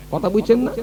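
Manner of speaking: usual speaking voice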